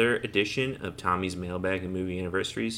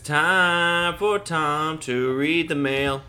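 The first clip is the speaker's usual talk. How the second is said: loud, high, slow show intro; announcer voice; 6 semitones higher, 6 dB louder, slower than usual. Acted. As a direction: belt it like a radio host. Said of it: "That was undoubtedly the worst one".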